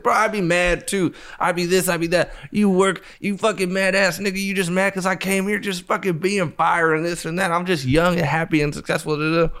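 high, cocky, mocking jeers; mock taunting. Taunting the big guy.

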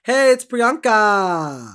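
high, loud, drawn-out excited greeting. Real, not acted. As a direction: gush hello down the phone.

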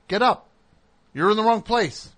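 loud and high, losing patience; loud command. Retold or imitated. Third try waking stranger.

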